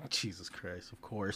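soft laughing exclamation. Real, not acted. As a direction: laugh it out quietly. Laughing at long title.

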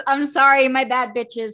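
loud, cheeky apology; loud mock apology. Real, not acted. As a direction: shout an unrepentant sorry. Unapologetic after shocking pick.

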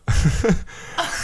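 real breathy burst of laughter; stifled laugh escaping mid-sentence. Real, not acted. Host cracking up while explaining his shopping, real laugh. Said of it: "Sorry, I started laughing".

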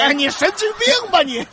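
high loud exasperated yell; exasperated shout. Acted. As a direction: blow up at him. Wife explodes.

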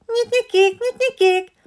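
high chirpy "Nyit-nyikék, nyit-nyikék" call; bright high bird call; tit birdsong. A narrator's reading. Birdsong imitation in story.

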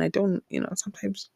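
normal talking voice

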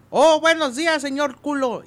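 high-pitched mock Chinese accent, r turned to l; chirpy foreigner greeting with a Chinese accent. Chinese man greets the torero with a mock Chinese accent. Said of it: "al día siguiente".

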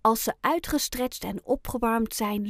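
ordinary delivery

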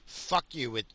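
usual speaking voice

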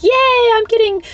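high, falsetto fake-cheerful yay; mock cheerful sarcasm. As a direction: squeal it like a cartoon optimist. Sarcastic mock cheer about disasters.

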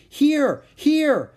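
very high, loud beckoning shout; loud calling. A narrator's reading. Annie beckoning wildebeest to a clear spot, calling. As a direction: call across a distance. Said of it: "she called".

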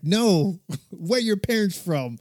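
loud, high "no" with a laugh; retold exasperated insistence. Pressing a British colleague about his family origins, exasperated. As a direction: push back in amused exasperation.

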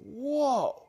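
high, drawn-out whoa of shock; stunned reaction. Retold or imitated. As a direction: react as if floored by what you overheard. Retold shock at mom's swearing.

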